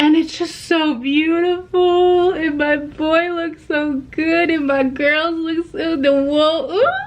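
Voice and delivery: high, swooning gush over the art. Real, not acted. Vlogger to viewers, swooning high over the print. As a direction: swoon over every detail.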